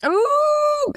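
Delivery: high, sustained "ooh" of surprise; delighted ooh. Real, not acted. Reacting to learning who played Mandelson, delighted high ooh. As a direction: let out a long, high-pitched ooh. Delighted on hearing the actor's name.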